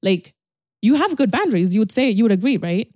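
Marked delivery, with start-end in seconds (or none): none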